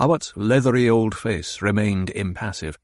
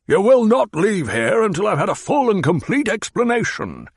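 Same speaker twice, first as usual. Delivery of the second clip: higher, louder threat; commanding demand; 11 semitones higher, 5 dB louder than usual. Acted. Baron forbids Hawat to leave, loud and commanding. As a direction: lay down the law.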